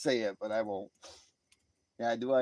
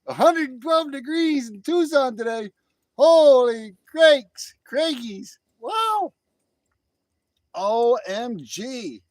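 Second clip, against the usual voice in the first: high, loud exclamations of disbelief; astonished; 11 semitones higher, 10 dB louder than usual. Real, not acted. Reacting to Tucson's heat, loudly astonished. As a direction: cry out in amazement, high and loud.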